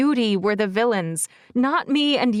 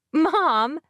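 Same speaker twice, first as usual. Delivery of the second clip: embarrassed protest; 7 semitones higher than usual. Acted. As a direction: stop teasing me, Mom.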